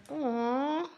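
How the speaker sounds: high cooing aw. Retold or imitated. Imitating viewer cooing at teddy bear energy, high coo. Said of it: "It's like".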